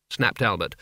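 ordinary delivery

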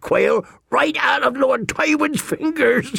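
high character voice laughing through the tale; chuckling old storyteller. Acted. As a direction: tell it high-pitched and amused, dropping to a warning.

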